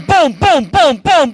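loud, high rhythmic boom sounds; pounding booms. Pretend. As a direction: beat it out like blows. Elephant pounding the lion.

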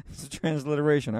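ordinary delivery

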